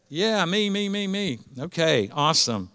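normal talking voice

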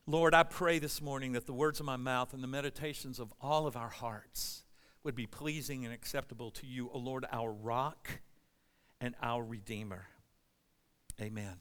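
low, hushed prayer voice, slowing toward the end. Real, not acted. Prays aloud before the sermon.

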